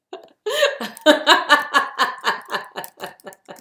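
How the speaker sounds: loud, long burst of real laughter; big laugh. Real, not acted. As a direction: cracks up at herself. Laughing at her own singing.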